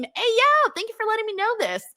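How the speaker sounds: real delighted shout-out; excited. Real, not acted. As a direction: burst out happily, fast. Excited by news of Englund.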